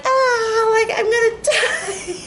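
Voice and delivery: high wailing cry, half laughing; high laughing wail of bad luck; mock wail. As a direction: wail it out like the world is ending. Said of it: "and I'm like".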